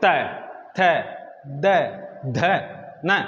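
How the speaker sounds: त, थ, द, ध, न each said alone with pauses; letter demo. Shown as a demonstration. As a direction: sound out त, थ, द, ध, न slowly. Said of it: "तो इसको बोल के देखिए आप".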